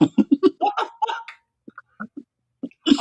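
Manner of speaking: high laughing what the fuck; laughing outburst. Real, not acted. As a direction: burst out laughing in disbelief.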